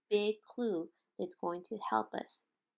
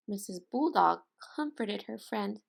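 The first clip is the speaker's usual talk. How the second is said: plain reading voice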